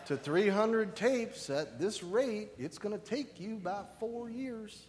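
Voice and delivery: slow, drawn-out preacher on tape; Chuck Smith. Retold or imitated. Preacher mimicking a taped Bible teacher's pace, slow drawl. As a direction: stretch the words out at a crawl. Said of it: "Chuck Smith spoke like this".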